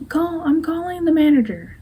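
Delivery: high-pitched, put-on Karen complaint. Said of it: "the term Karen, like, someone who".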